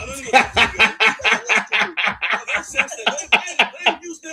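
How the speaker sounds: laughing through the words; slow, laughing disbelief; loud laughing protest. Real, not acted. Laughing during heated exchange.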